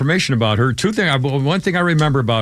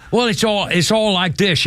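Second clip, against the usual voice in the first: put-on Australian accent; 7 semitones higher than usual. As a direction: lay on a thick Australian accent. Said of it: "Can you do an Australian accent".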